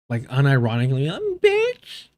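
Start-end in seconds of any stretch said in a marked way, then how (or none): 1.1-2.1 very high squeaky mimicry; mocking his voice. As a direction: mimic him in a high falsetto. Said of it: "You can hear it in his voice too".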